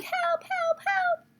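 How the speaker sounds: very high shrieking help help help; mock shriek. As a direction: imitate a panicked teacher screaming. Mocking screaming teachers.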